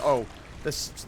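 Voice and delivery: usual speaking voice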